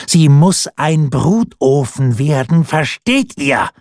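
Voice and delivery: langsam und etwas höher, wütend gepresst; kalte Wut. A narrator's reading. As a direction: jedes Wort langsam hinausgeschleudert vor Zorn. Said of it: "schrie sie". (Diana schreit den König in kalter Wut an)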